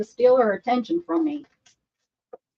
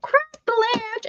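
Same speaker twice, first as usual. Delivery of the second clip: very high, drawn-out call; high-pitched retold call; 14 semitones higher, slower than usual. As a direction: sing it out high and slow, retelling your own line. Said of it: "and I said".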